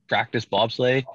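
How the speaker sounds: usual speaking voice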